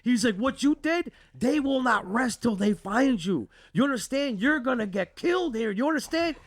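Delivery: cousin's worked-up warning, acted out; alarmed scolding. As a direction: chew him out in a high, panicked pitch. Cousin's angry, urgent warning retold.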